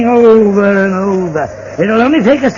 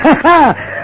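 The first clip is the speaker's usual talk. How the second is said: mocking laugh; 7 semitones higher than usual. Villain laughs at victim.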